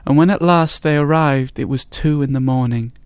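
ordinary delivery

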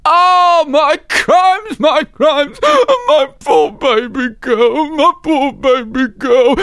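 high, loud, slow wail; wailing mother. A narrator's reading. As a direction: howl over the poor baby. Mother wails again.

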